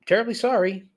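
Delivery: emphatic retold apology. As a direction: push the apology up high and loud. Retold repeated apology to angry fan.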